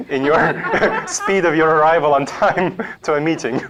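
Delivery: laughing through the words; chuckling mid-sentence, words slowed. Real, not acted. Lecturer to class, laughing through the point. Laughing while explaining lateness.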